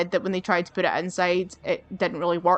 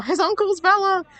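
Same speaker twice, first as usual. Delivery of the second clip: "His uncle's villa!" echoed much higher and louder; amused exclamation; 11 semitones higher, 4 dB louder than usual. Real, not acted. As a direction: repeat "His uncle's villa!" with delighted disbelief.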